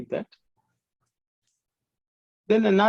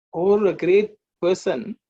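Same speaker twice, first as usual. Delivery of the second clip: ordinary talk, nothing marked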